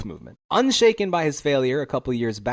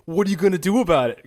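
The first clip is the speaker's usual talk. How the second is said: higher, louder taunting challenge; mock scoffing; 6 semitones higher, 5 dB louder, faster than usual. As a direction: scoff it like a defiant dare. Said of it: "scoffs at this old man and does this".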